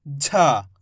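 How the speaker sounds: झा said low with a breathy j; heavy jh sound. Shown as a demonstration. Teacher modelling heavy झा for beginners. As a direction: sink the voice and breathe on झा. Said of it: "इसका उच्चारण कैसे करेंगे?".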